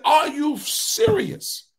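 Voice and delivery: slow, high, astonished question; amazed. Retold or imitated. Preacher retelling his reaction to the dealer, slow amazed question. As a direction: let the disbelief hang.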